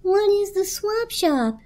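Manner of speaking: high, slow curious question; blanket's high voice. A narrator's reading. Blanket asks the radio about the program, high and slow. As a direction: ask it small and high. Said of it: "asked the blanket".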